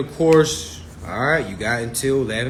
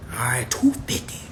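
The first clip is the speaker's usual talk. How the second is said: whispered aside; a whispered price reminder; 3 dB quieter than usual. Real, not acted. Unvoiced whisper.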